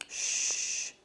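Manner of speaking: soft shushing breath; hushing whisper. Acted. Mum hushes the sobbing boy, soft whispered shh. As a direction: hush the child gently. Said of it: "said Mum".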